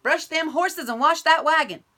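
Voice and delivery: loud, high yelled name; shout. Acted. Stepbrother yelling for Bubba, loud and high. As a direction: bellow his name across the ranch. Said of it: "shouted his wicked stepdaddy".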